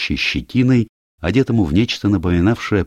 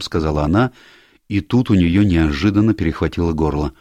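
usual speaking voice

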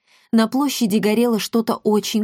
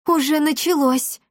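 put-on higher-pitched girl's delight; 7 semitones higher than usual. A narrator's reading. Girl rejoicing that the uprising has begun, delighted. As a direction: exclaim it gladly, pitched up. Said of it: "обрадовалась Дарина".

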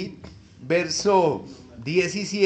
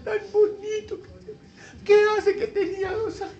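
doting mother; 12 semitones higher than usual. Retold or imitated. Mother admiring child's diploma photo.

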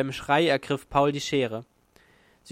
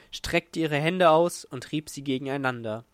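ordinary delivery

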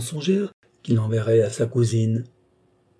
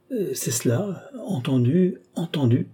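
higher, caught off guard; dazed agreement; 6 semitones higher than usual. A narrator's reading. Athanase stunned at his own acceptance of the hotel, higher. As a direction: agree despite himself, stunned. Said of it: "stupéfait d'entendre ce que sa bouche prononçait".

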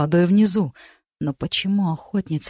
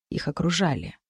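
ordinary delivery, nothing marked

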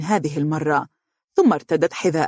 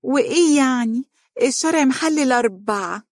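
higher, light and dainty; softly coy; 8 semitones higher than usual. A narrator's reading. Wife teasing husband about four wives, coy and higher. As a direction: tease gently in a higher voice. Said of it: "تقول برقة".

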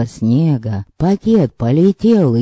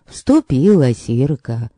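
ordinary delivery, nothing marked

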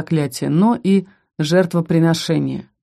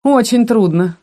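higher, louder mock agreement; 5 semitones higher, 5 dB louder than usual. A narrator's reading. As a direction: agree while plainly sneering. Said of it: "насмешливо согласился староста".

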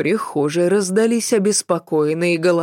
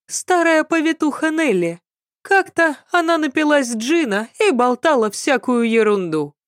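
high-pitched maid character voice; gossiping maid; 8 semitones higher than usual. A narrator's reading. Maid telling servant of tipsy midwife, high gossipy voice.

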